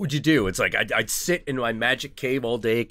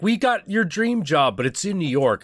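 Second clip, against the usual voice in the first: cheery job offer voice; 10 semitones higher, 4 dB louder than usual. Retold or imitated. S0 imagining a recruiter's offer, bright high sales voice. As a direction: sell it sweetly like a recruiter.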